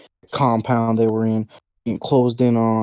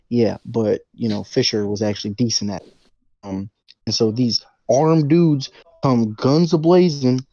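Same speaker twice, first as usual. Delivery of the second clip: usual speaking voice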